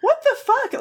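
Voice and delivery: very high, amused exclamation; incredulous outburst. Real, not acted. Podcaster reacting to a nonsense analogy, incredulous. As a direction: exclaim it in comic disbelief. Comic outrage at a bad analogy.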